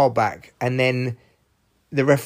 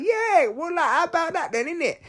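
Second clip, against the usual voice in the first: put-on squeaky high street accent; tiny falsetto street kid, soft at the end; Afghanistan; 4 dB quieter than usual. Afghanistan chiming in late, squeaky silly street accent. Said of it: "this is me using silly voices".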